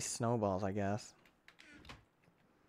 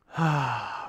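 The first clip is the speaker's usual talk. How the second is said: long exhale of relief; relieved sigh. Real, not acted. Player safe after fleeing the wrong way, relieved sigh. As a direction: let out a big breath.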